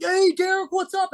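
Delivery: very high mock fan hail; squeaky-high put-on hello to someone spotted; excited greeting. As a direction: imitate a customer calling out, pitch shot up. Regular spots him, calls out.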